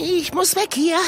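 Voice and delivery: very high, squeaky voice, wanting out; squeaky panic. Acted. Scared little creature squeaks that it must flee.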